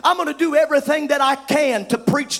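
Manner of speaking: rising, shouted preacher's cadence; full-force preaching. Acted. Preacher to his congregation, full-force preaching. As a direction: drive it home to the pews.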